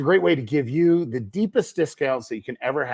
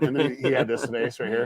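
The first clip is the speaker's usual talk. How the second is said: usual speaking voice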